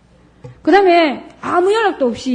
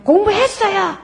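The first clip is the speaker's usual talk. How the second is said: put-on defensive child; 9 semitones higher than usual.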